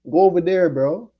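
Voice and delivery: loud go-away command; telling off. Real, not acted. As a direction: shove them away with your voice. Telling females to leave.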